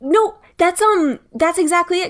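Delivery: high-pitched, excited realization; real excitement, pitched well up; very excitable. Real, not acted. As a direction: blurt it out as it suddenly clicks. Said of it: "I got very excitable".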